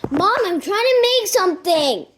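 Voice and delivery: loud, high complaint to mom; whining protest. Real, not acted. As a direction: call out, fed up with being interrupted.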